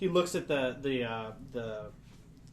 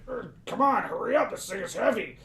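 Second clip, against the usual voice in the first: higher, louder, hurried grumble; straining bugbear; 6 semitones higher, 4 dB louder than usual. Acted. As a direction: urge them on under heavy weight. Bugbear holds heavy lid.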